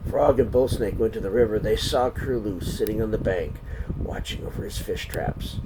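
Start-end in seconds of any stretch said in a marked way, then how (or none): none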